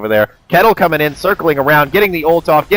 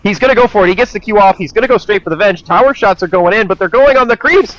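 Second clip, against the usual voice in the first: fast rising esports call, pitch climbing; excited play-by-play; 9 semitones higher, 4 dB louder than usual. Real, not acted. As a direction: call the fight as it unfolds, getting higher. Live play-by-play building.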